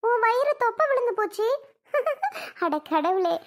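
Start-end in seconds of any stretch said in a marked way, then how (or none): 0.1-1.5 higher, amused mock-shocked question; playful surprised tease; teasing surprise. Real, not acted. Teasing sister about her belly. 1.9-2.6 high, amused giggle; giggling at the belly joke. Real, not acted. Laughs at belly remark.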